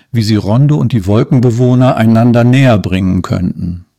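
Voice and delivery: ordinary delivery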